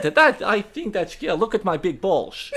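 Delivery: slow, hammed-up Dutch accent. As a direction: drawl it like a Dutch footballer. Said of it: "that's not too bad".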